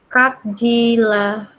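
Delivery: "Kak Jila" drawn out very slowly; slow pronunciation demo. Shown as a demonstration. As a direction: stretch both words of "Kak Jila". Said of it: "bacanya jadi Kak Jila".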